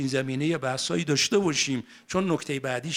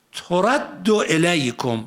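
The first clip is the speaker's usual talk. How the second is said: Quran recitation; 3 semitones higher, 5 dB louder, much slower than usual. Acted. Verse continues, chanted.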